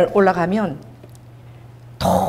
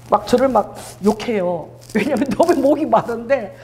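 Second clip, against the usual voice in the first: ordinary delivery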